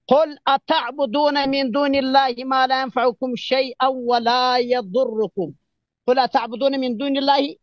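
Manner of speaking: slow, measured Arabic recitation; Quran recitation. Acted. Debater reciting a verse as proof, slow recitation. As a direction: intone the verse slowly and reverently.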